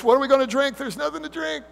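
put-on high, whiny childish complaint; whiny children. Israelites griping to Moses in the desert, high childish whine. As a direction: whine it like a spoiled kid. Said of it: "they sounded often like children".

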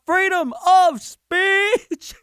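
high, drawn-out yell; screaming out. Pretend. Handcuffed man yelling at police, slow high yell. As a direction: scream it while being dragged away. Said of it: "you start screaming out".

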